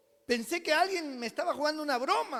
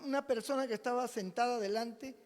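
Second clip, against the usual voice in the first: ordinary talk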